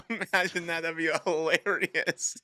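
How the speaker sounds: high, giggling disbelief, laughing through the words; cracking up at the idea. Real, not acted. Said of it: "with laughter".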